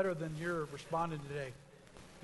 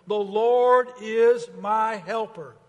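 slow, loud and higher; proclaimed declaration; 4 semitones higher, 12 dB louder, slower than usual. Shown as a demonstration. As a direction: declare it to the room. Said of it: "Let's say it together".

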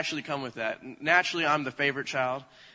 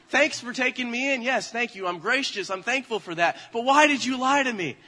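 high-pitched, hammed-up wounded protest; flustered confrontation; 7 semitones higher, 4 dB louder than usual. Child confronting parents about being found, flustered and hurt. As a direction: give mock thanks, then burst into accusation. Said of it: "I'm getting all flustered".

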